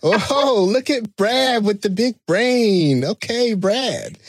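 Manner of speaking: high, slow sarcastic mock Samuel Jackson; imitating the actor mocking a jargon talker. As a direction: drawl it out with teasing scorn. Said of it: "I hear him saying".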